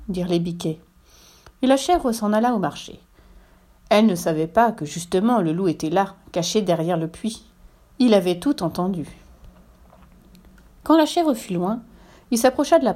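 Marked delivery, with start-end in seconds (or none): none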